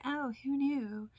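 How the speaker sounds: high, slow mock surprise; sarcastic. Real, not acted. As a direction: play it dry and ironic. Sarcastic remark on cafe cakes.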